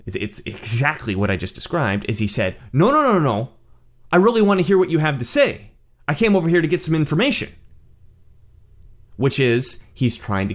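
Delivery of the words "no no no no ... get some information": high, insistent protest; pleading innocence in a raised pitch; earnest protesting. Retold or imitated. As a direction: act out someone hastily insisting they mean well. Acts out rival backpedalling.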